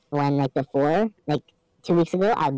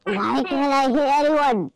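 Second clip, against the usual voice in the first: frustrated whine; 8 semitones higher, much slower than usual. Real, not acted. Frustrated mid-game.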